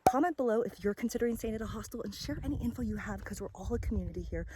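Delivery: quick, breathless outro rush; breathless at altitude, pushing through; out of breath. Real, not acted. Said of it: "Whew, I'm out of breath".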